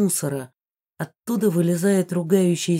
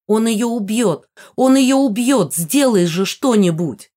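high, loud panicked plea; desperate shout; 4 semitones higher, 6 dB louder than usual. A narrator's reading. Heroine begging Гидеон to stop a killing, desperate shout. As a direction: cry out for him to act. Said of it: "крикнула я Гидеону".

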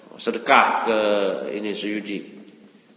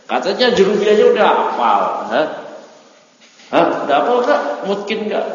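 teasing challenge; 8 semitones higher, 5 dB louder than usual. Real, not acted. Teacher teasing student's claim.

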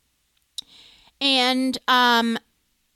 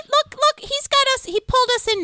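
much higher, louder excited pointing; giddy excitement; 13 semitones higher, 3 dB louder than usual. Real, not acted. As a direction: gush over the photo like a fan. Gushing over Jim photo.

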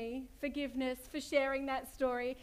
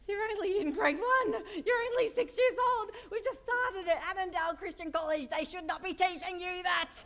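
a raised, mildly scandalised protest; shocked mum; 3 semitones higher than usual. Retold or imitated. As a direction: play the mock-horrified parent. Mum's shocked reply to son.

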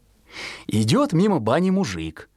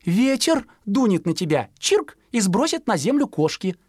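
higher sparrow-mum voice; higher chirpy mother; mother explaining; 9 semitones higher than usual. Acted. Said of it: "объяснила мать".

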